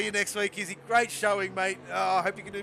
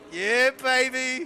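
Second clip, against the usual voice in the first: relishing triumph, loud and slow; 5 semitones higher, 11 dB louder, much slower than usual. Real, not acted. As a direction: savour it, stretch every syllable. Celebrating his Ferrari fandom.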